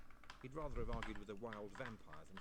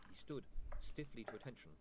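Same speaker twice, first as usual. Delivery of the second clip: plain reading voice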